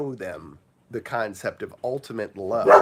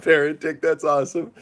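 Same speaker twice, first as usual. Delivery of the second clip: ordinary talk, nothing marked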